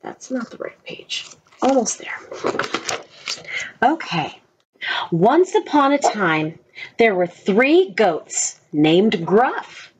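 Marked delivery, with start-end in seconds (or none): 0.0-4.4 whisper. Real, not acted. Whispering to self.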